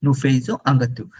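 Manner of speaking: ordinary delivery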